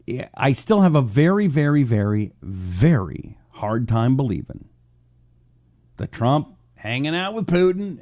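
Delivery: normal talking voice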